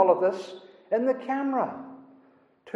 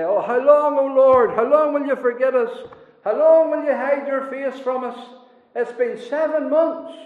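raised, lamenting cry to God, louder; pleading prayer; 5 semitones higher, 6 dB louder than usual. Retold or imitated. Believers missing the Lord cry out in prayer, lamenting loudly. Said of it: "and they say".

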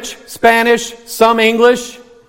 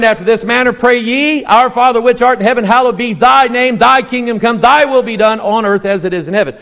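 brisk, lilting scripture recital; King James reading; 4 semitones higher than usual. Shown as a demonstration. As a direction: recite the old King James wording with flow. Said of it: "So now say it the other way".

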